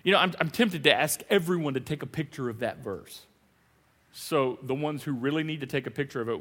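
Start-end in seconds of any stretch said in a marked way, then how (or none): none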